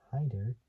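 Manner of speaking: "hider" said like "hyper" but with a D; hider pronunciation. Shown as a demonstration. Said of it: "it's like saying the word hider".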